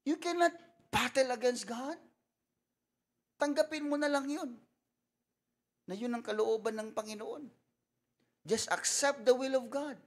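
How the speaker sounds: high, loud fervent preaching; full-force preaching. Acted. Pastor urging the congregation to cry out, loud and high. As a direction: preach it hard to the crowd.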